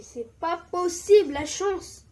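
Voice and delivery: loud, high cry of disbelief at his luck; can't believe the luck, half shouting; thrilled disbelief. Retold or imitated. Gamer marvels at his lucky find, thrilled loud disbelief.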